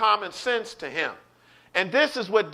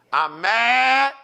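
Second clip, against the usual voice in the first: rising, louder declaration of being mad; angry; 4 semitones higher, 5 dB louder than usual. Acted. Telling someone to leave me alone, angry. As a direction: let the anger climb to the last word. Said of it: "when you get angry".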